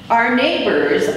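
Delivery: ordinary delivery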